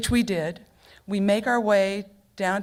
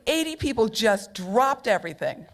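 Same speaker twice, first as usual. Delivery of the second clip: usual speaking voice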